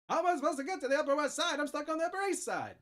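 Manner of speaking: put-on high, fast old-time radio announcer; old-timey announcer. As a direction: rattle it off like a vintage newsreel. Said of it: "old-timey announcery".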